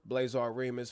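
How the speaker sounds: usual speaking voice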